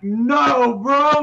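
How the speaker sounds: loud, high drawn-out denial; acted fanboy outburst; shouted disbelief. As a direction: cry out to a buddy, stunned. Voicing a viewer's stunned reaction.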